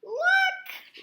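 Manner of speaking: very high, loud little cry; excited look. A narrator's reading. Little Wee Bear calling out to his parents, excited. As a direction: squeal it out like a startled cub.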